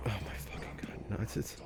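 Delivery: breathless whisper, almost no voice; stunned whisper. Real, not acted. Player whispering to herself in shock, stunned whisper. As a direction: hush it like you can't believe it.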